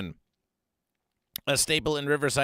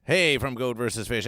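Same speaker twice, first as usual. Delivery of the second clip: normal talking voice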